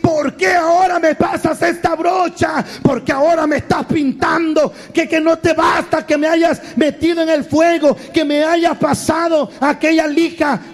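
high-pitched indignant protest; hammed-up aggrieved wail; complaining clay. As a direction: pile up grievances at the potter, voice high. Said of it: "comienza a quejarse y decir".

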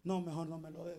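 barely voiced private thought; inner thought. Preacher thinking while the pastor offers time, soft aside. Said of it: "digo yo en mi mente".

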